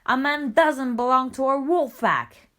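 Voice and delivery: the pack shouting their objection; crying out. A narrator's reading. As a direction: wolves crying out in protest. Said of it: "cried the young wolves".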